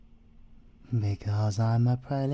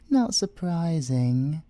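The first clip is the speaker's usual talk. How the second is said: ordinary talk, nothing marked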